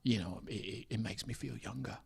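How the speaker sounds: soft, breathy invite; hushed Statham voice. Retold or imitated. Jason Statham asking a comedian to hang out. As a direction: murmur it, shy and eager.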